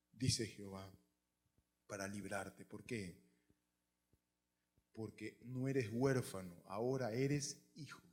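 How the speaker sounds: intimate, quiet promise with pauses; hushed reassurance. Real, not acted. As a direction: reassure them gently, voice dropped right down. Preacher dwells tenderly on God's promise.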